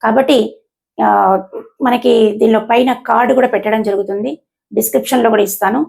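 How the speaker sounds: ordinary delivery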